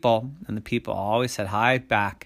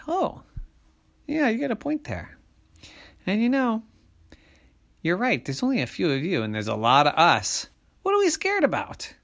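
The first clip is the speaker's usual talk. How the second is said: high-pitched dawning agreement; conceding monsters; 9 semitones higher than usual. A narrator's reading. Monsters admitting the person has a point, conceding. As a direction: come round to it, voice lifted.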